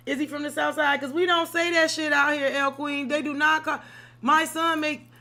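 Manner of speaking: loud mock-offended disbelief; indignant outburst. Real, not acted. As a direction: call it out like it's an insult. Indignant outburst about south side.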